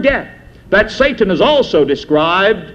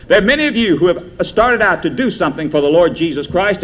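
ordinary talk

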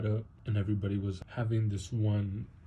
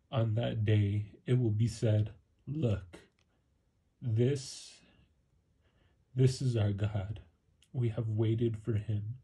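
slow, louder solemn Bible reading; scripture reading; 3 dB louder, slower than usual. Acted. Believer reading Isaiah twenty-five to viewers, slow and solemn. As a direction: read it out slowly, like a proclamation.

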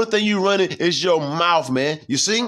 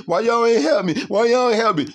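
mock helpless woman; 6 semitones higher than usual. Preacher mocking women who cry for help, in a higher pleading voice. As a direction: mimic a woman begging for help, mocking her. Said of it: "They say".

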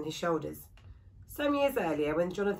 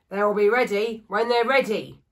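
louder, impatient brush-off; curt woodcarver; 2 semitones higher, 8 dB louder than usual. Acted. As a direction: cut her off, grumpy. Woodcarver snaps at impatient widow.

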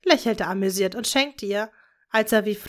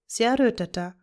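ordinary delivery, nothing marked

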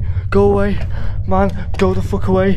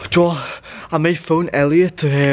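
ordinary delivery

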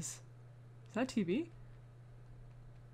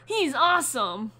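put-on higher, louder, upbeat boy's voice; Kel voiced eagerly; 12 semitones higher, 6 dB louder than usual. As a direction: pump it up like a cocky buddy. Reading Kel's game dialogue.

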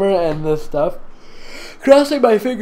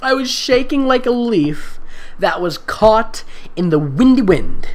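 slow, higher dramatic reading, punching "windy"; mock dramatic reading; 6 semitones higher than usual. Shown as a demonstration. Reader mocking the silly simile with dramatic flair. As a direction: overact the line's drama. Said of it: "Why do you think this is a good thing".

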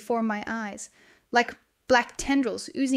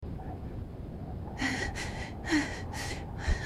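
long breathy exhausted sigh; weary sigh before admitting she's lost; shaky sigh; 5 semitones higher, 10 dB quieter than usual. Acted. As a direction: let out a drawn, unvoiced sigh. Lost and scared on the fells.

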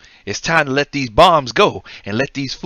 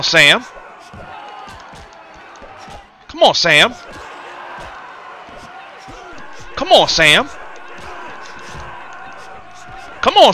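taunting build; 4 semitones higher than usual. Acted. Boxer taunting his opponent, rising to a jeer. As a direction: taunt him more each time, building to a jeer.

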